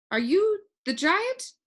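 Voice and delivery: high, rising, slowly unsure; doubtful question. A narrator's reading. Talk doubts the small man at the door is the giant. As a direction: ask it like you can't believe him. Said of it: "asked Talk doubtfully".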